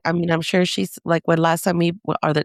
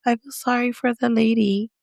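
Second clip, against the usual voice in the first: imitated sad child; 5 semitones higher than usual. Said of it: "Why are you sad".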